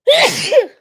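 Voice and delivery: sudden loud, high-pitched sneeze; real sneeze. Real, not acted. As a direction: sneeze explosively. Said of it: "I feel a sneeze coming on".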